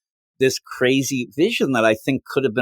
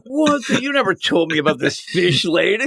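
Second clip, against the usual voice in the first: high, startled opening word, then settling a little; mock indignant surprise; 5 semitones higher than usual. Lois confronting Superman about the fish lady, mock indignant. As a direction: act out a scandalized partner.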